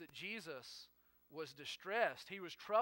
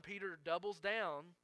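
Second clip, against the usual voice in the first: ordinary talk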